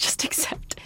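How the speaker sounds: whispered through laughter; breathy giggling whisper; laughing whisper. Real, not acted. Laughing self-talk.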